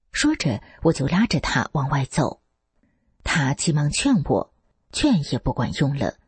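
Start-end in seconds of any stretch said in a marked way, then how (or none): none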